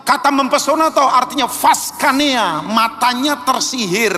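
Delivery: high-pitched, driving sermon delivery; impassioned scriptural rebuke; forceful preaching. Acted. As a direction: hammer out each contrast to the congregation. Preaches the verse at full force.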